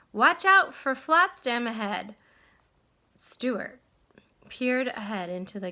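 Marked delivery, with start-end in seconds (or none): 0.1-2.2 louder warning cry. Acted. Owner warning Stuart from shore, loud cry. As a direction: warn him urgently from shore. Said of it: "cried the owner".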